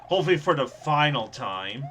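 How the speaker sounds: ordinary delivery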